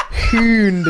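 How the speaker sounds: "Hund" drawn out with a silly foreign vowel; mock Norwegian word. Joking about the dog magazine, "Hund" in a mock Norwegian sound. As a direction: say "Hund" long and goofy. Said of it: "how fucking stupid that sounds".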